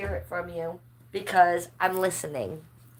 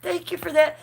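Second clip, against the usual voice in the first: laughing thanks; 10 semitones higher than usual. Real, not acted. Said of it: "I'm crying".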